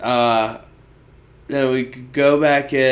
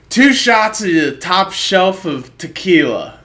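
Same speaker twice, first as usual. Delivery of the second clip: grand order; 6 semitones higher, 5 dB louder than usual. Retold or imitated. Guy ordering from bartender.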